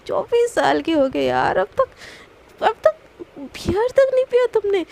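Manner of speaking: can't believe it, building up; astonished. Acted. Friend astonished he never drank.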